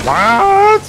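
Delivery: high startled yelp; shocked. Real, not acted. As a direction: jump up in pitch, startled. Caster reacts to sudden explosion.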